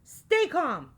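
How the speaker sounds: high, loud urgent order; shouted. A narrator's reading. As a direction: shout to steady the panicking friends. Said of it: "shouted Al".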